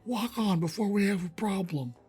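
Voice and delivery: put-on high, loud, cranky old trapper; creepy old man voice. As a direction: snap it like a creepy old geezer. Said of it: "That's what he tells you".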